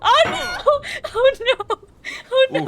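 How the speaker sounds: high, loud panicked cry. Real, not acted. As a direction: yelp it as you fall. Repeated panicked cries while falling.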